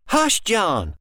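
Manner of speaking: put-on mild childish retort; little girl. Mimicking mother's hush.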